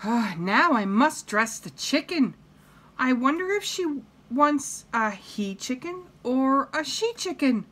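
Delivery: high-pitched puzzling to herself; musing cheerful housemaid. Acted. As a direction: wonder aloud in a high storybook maid voice. Voices Amelia in the story.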